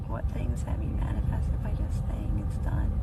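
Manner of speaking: barely audible whispered reading; reading under breath. Real, not acted. Streamer reading a viewer's question to herself, whispering. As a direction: murmur a comment to yourself.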